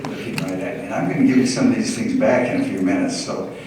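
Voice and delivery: normal talking voice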